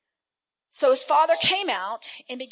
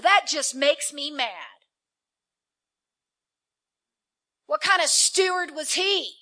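outraged; 4 semitones higher, 8 dB louder than usual. Acted. Elder son outraged at brother.

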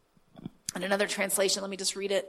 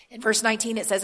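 ordinary delivery

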